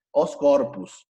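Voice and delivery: corpus ending in a half-closed "us". Shown as a demonstration. Teacher models the plural, corpus with half-closed U. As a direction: say corpus with U in the last syllable. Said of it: "medio cerrada".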